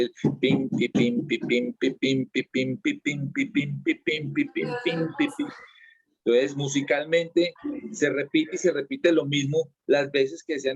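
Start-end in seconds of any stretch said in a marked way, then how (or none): 0.0-5.5 rasgueo de tiple cantado con la boca, saltos agudos; imitación del tiple. Retold or imitated. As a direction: imita el tiple con pim y pi, a ratos muy agudo y susurrado. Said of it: "al meterle el tiple". (imitando el rasgueo del tiple con la voz)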